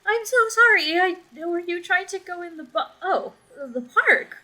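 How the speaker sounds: frightened mother fumbling to point the way; flustered and scared. Acted. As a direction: stammer out directions, flustered by the stranger. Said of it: "She looks a bit terrified".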